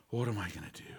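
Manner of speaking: defeated sigh. Acted. Slumped in chair despairing.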